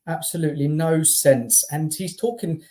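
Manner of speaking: usual speaking voice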